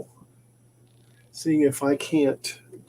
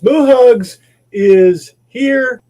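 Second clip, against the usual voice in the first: excited greeting; 6 semitones higher, 7 dB louder, much slower than usual. Real, not acted. Host greets arriving viewer.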